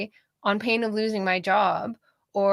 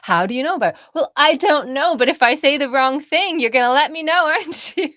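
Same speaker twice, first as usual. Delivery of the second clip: loud, higher-pitched acted comeback; mock retort; 6 semitones higher, 7 dB louder than usual. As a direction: argue back in a raised, mocking voice. Acted mock exchange, heckling back.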